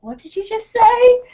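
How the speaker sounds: imitated baffled listener. Caller to coworker on the phone, imitated bafflement. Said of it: "they were just like".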